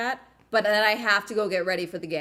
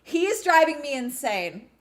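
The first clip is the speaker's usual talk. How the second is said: defensive; 6 semitones higher, 6 dB louder than usual. Real, not acted.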